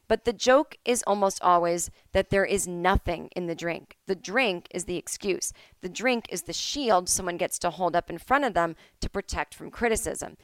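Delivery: ordinary talk, nothing marked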